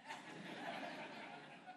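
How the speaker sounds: a breathy, high-pitched little laugh; imitated laugh. Pastor imitating James laughing after reading, breathy and high. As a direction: mimic his startled laugh. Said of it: "went like this".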